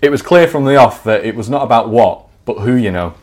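measured storyteller voice; story narration. Acted. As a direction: read it like a comic memoir. Reads his story aloud.

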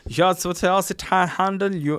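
loud, high, drawn-out German; mock German accent. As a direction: ham it up as a German. Playing with German translation.

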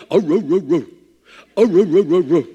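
rhythmic mock barking ro ro ro; imitated dog bark. Pastor imitating the neighbor's barking dog. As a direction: bark it out in a steady cadence. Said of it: "obnoxious barks that's in a cadence".

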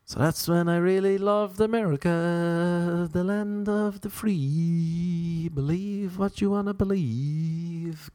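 high, slow, mock-patriotic tribute; mock grandiose. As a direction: drag it out like an anthem. Mock grand ode to America.

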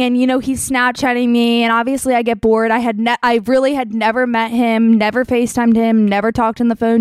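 ordinary delivery

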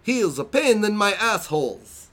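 high-pitched mock Italian accent; over-the-top Borat-esque Italian accent; hammy Italian-Borat accent. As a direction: read it in a ridiculous Italian accent going into Borat. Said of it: "I'm gonna read it in the best accent that I can".

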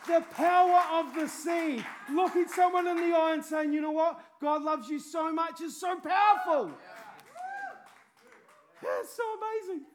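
loud, high shouted proclamation; fiery preaching. Real, not acted. Preacher shouting to the congregation, fiery preaching. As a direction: shout it out to the whole room. Preaching peak, repeated phrase.

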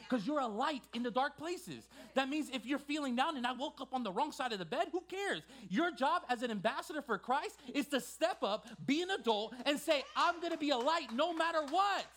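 high, loud rallying sermon, building to a shout; impassioned preaching. Acted. Preacher urging ambassadors for Christ to step up, building full-force preaching. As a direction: drive it up to a ringing declaration.

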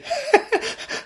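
high, hysterical laugh in disbelief; put-on laugh tipping into crying; imitated laughing-crying. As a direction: act out helpless, disbelieving laughter. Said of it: "laughing, crying, and he's going".

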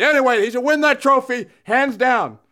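lightly put-on hockey pundit impression; a hint of blustering TV hockey pundit; Don Cherry. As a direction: rant like an opinionated hockey commentator. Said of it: "You're the Don Cherry".

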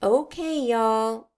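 drawn-out, light Southern twang; Southern Afghani accent. Real, not acted. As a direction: let a playful Southern accent slip out on y'all. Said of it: "my Southern Afghani accent comes out".

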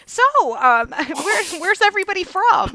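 high, giggly party small talk; mock awkward small talk. Friend retells arriving at the party, high and giggly. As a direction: ask the room sweetly like a nervous newcomer.

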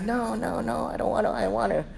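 high, soft mock whine; whining child. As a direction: imitate a reluctant little boy pleading. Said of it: "And he's like".

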